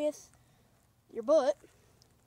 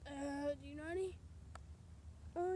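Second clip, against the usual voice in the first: ordinary delivery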